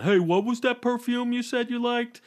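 high goofy husband asking his wife; mock clueless husband. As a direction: play the oblivious guy giving himself away. Mocking clueless husband asking later.

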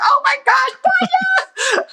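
high, loud shocked cry of her name; alarmed shout. Retold or imitated. Boss hearing of smoke in the lab, shocked high shout.

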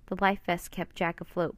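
plain reading voice

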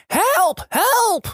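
imitated high-pitched cry for help; screaming older lady. Older lady calling out as a purse thief flees, mock high scream. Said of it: "an older lady screaming".

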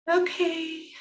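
high, drawn-out doubtful okay; skeptical okay. Retold or imitated. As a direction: stretch it out up high, biting your tongue. Holding back a reply to husband.

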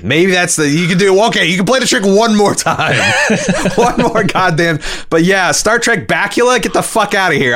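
high, laughing mock permission. Real, not acted. Laughing rant about bringing back actors.

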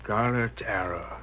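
fearful awe. Acted. Awed fear of the legend.

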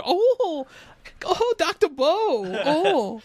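high-pitched mock moan; high moaning woman. Imitating women at chiropractor.

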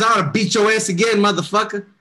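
high, loud threatening yell; angry threat. Retold or imitated. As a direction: threaten the thief to his face. Threatening the thief.

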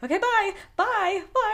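high, loud, chirped farewell imitation; hurried goodbye. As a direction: shoo them off with a sing-out goodbye. Retold hurried preschool goodbye.

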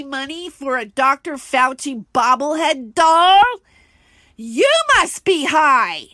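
loud, scornful and slowed on the last words; loud incredulous scorn; scornful outburst. Real, not acted. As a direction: blast the idea as ridiculous. Scoffing at the bobblehead doll idea.

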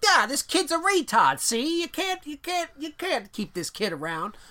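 high, drawn-out mock outburst of dismissal; expected scornful character. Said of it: "they're gonna be like".

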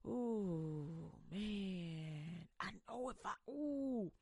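soft, half-whispered inner struggle; Adam torn between wife and Father, whispering; tempted whisper. Acted. Adam torn, muttering to himself.

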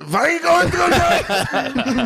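very high, drawn-out German rant; hammed-up German dictator accent; Hitler. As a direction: shriek a mock German tirade, stretched out. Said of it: "That's just a Hitler accent".

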